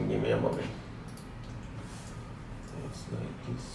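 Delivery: very soft, low talk to oneself; barely voiced, attention elsewhere; quiet aside. Real, not acted. Teacher busy setting up for viewers, hushed aside.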